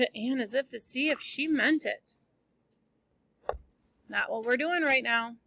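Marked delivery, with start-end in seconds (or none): none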